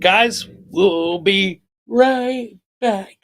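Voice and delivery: loud, slow announcer voice; announcer sign-off. Real, not acted. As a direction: stretch it like a host going to break. Announcing a break to viewers.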